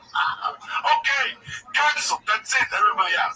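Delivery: high, loud mock announcement; imitating a fed-up club owner; owner shutting it down. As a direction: call off the show loudly. Owner cancels show, acted.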